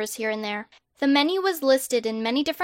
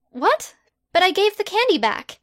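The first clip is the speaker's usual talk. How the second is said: higher, exasperated protest; indignant girl; 8 semitones higher, 3 dB louder than usual. Acted. Hermione protesting her arrest to Harry, higher and indignant. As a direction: push back in disbelief.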